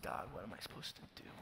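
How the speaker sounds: soft, hushed plea to God; whispered plea. Pretend. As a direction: barely whisper the question. Voicing a humble prayer.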